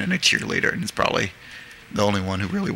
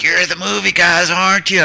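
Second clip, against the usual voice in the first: Mamie; 8 semitones higher, 4 dB louder than usual. Retold or imitated. Imitates Mamie calling from pickup.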